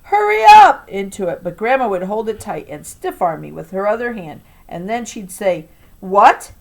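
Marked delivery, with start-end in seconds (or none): 0.0-0.9 put-on high, loud child's yell. Boy yelling at his mom through the phone, urgent yell. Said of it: "so I could yell". 6.0-6.5 loud questioning "What?"; mock surprise. Grandma reacting to mom on the phone, loud surprise.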